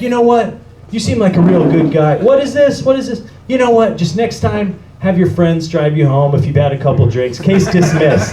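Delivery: high, loud, breezy imitation of a judge; chummy judge. Judge waves away Johnny's charge in court, chummy. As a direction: let him off like an old pal. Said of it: "He's like".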